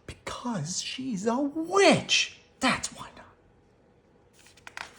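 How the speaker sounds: slow, spooky voice fading to a whisper; spooky hushed. A narrator's reading. Cubs telling Mama the neighbor is a witch, spooky whisper. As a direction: breathe out the secret like a ghost story.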